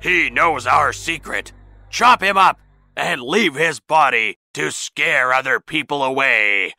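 menacing loud command; shouting leader. A narrator's reading. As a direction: bellow orders to the gang. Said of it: "shouted the leader of the thieves".

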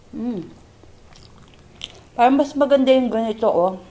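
speaking with food in mouth; mumbling between bites; chewing while talking. Real, not acted. Seen 4: Puts food in mouth at -1, hand at mouth, then chews while speaking.

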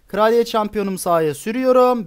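ordinary talk

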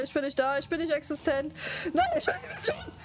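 high panicked wail; panicking gamer. Real, not acted. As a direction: cry out in real panic. Gamer panicking, wants to vanish.

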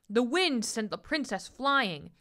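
usual speaking voice

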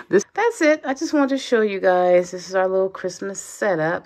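usual speaking voice

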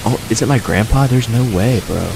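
ordinary talk, nothing marked

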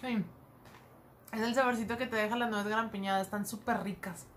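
chewing while talking; speaking between bites; mouth full of food. Real, not acted. Seen 1: Woman chews snack between words, bites again mid-phrase.

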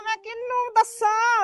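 anguished wail. Acted. Mother laments her daughter's betrayal.

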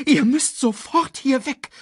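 high, urgent order; commanding. A narrator's reading. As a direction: order the cubs away at once. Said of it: "befahl Sasu".

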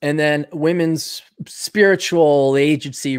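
ordinary talk, nothing marked

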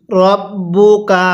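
very slow "রব্বুকা", held double b; model recitation. Shown as a demonstration. Teacher models it for learners, slow measured "রব্বুকা". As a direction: stretch out "রব্বুকা" syllable by syllable. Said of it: "কিভাবে পড়ব".

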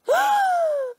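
loud, high, drawn-out gasp; hammed-up gasp of shock. Catching Todd at another girl's house, shocked gasp.

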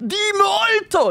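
high, slow passionate Italian Di molto; high, drawn-out hammed-up Italian cry; imitating Di molto. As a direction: belt out Di molto with JoJo passion. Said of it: "start the line with".

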